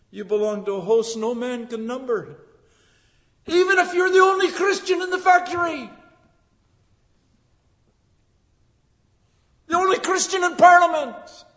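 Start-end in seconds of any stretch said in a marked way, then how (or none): 3.5-11.4 high, fast ringing shout; preaching shout. Real, not acted. Preacher rallying the lone believer, shouting. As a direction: lift it to a rousing cry.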